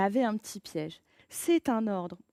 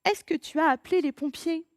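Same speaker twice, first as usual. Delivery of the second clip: urgent question; 6 semitones higher, 4 dB louder than usual. Acted. Anxious question in fire scene.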